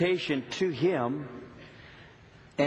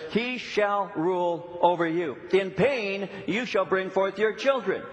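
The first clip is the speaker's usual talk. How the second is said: raised, measured Bible reading; scripture reading; 4 semitones higher than usual. Acted. Preacher reading God's words to Eve, solemn scripture voice. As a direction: lift the verse up and read it solemnly. Reads Genesis verse aloud.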